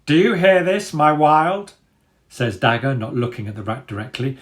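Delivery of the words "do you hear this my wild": a higher, louder commanding dog voice; proud, sneering leader addressing the crowd; Dagger's voice. A narrator's reading.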